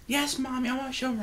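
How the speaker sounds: put-on high, soft little girl voice; little girl reply. Little girl answering her mommy about where Patches was seen. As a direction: answer sweetly like a small child.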